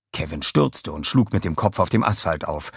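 ordinary delivery, nothing marked

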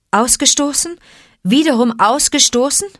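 can't believe he's cast out again; stunned disbelief. Acted. Mowgli hurt by rejection. (fassungslos, viel höher, steigend)

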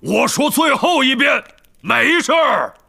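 loud, high, exasperated insistence; emphatic, fed-up denial; adamant. A narrator's reading. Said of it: "海格一口咬定".

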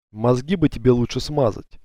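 ordinary delivery

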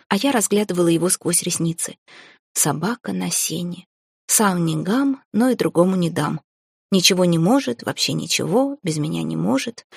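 ordinary delivery, nothing marked

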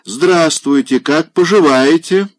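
high, loud, hearty hello; loud hearty greeting. A narrator's reading. Old general greets Polina loudly and heartily. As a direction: boom the greeting at her.

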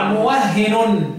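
موهن said very slowly as muwahhenun, doubled h with an added -un ending; doubled with tanween. Shown as a demonstration. Teacher reciting the doubled reading for the student, muwahhenun. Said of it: "بالتنوين طبعًا".

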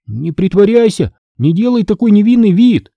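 high, heated accusation; indignant high-pitched retort; sharp reply. A narrator's reading. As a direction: answer back sharply, rising in pitch. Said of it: "резко ответил я".

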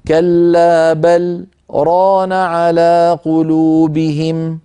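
very slow chanted recitation with a short pause on the لام of بل; recitation with saktah. Shown as a demonstration. Teacher shows the fourth pause, in very slow chanted recitation. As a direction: chant slowly, break briefly after بل before ران. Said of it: "السكتة الرابعة على اللام".

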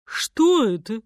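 mildly indignant question; angry elephant. A narrator's reading. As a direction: demand it with displeasure. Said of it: "В голосе слонихи был гнев".

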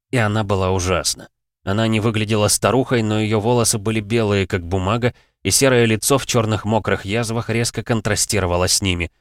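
ordinary delivery, nothing marked

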